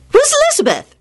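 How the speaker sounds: high-pitched, demanding question. A narrator's reading. Rosie asking who Elizabeth is, demanding. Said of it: "demanded Rosie".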